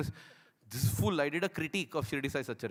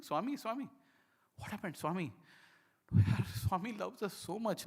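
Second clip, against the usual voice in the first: retold crying; 4 semitones higher than usual. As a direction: stretch it out as if overcome by tears. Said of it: "I'm just crying".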